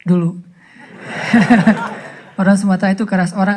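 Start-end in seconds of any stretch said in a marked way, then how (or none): 1.0-2.1 real chuckle in short bursts; amused chuckle. Real, not acted. Speaker amused by his own aside, chuckling. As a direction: chuckle at your own joke.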